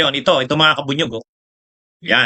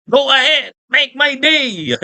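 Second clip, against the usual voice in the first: higher, very slow tough-guy line, hammed up; mock tough guy; 6 semitones higher, much slower than usual. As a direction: drawl it like a movie hard man, mocking. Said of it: "matatapang sila". (parang nagmamatapang na artista sa pelikula, panggagaya)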